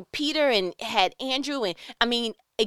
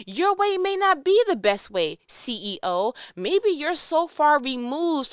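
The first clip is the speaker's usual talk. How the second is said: louder and higher, pressing hard on be; bold call-out; 5 semitones higher, 4 dB louder than usual. Shown as a demonstration. To the CEO, daring to challenge him: loud, high call-out. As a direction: call the boss out with force. Same line aimed at the CEO.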